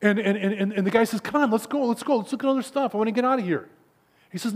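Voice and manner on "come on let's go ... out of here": higher-pitched, restless urging; impatient friend. Acted. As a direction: pester a friend to leave, lightly acted. Bored friend urges leaving.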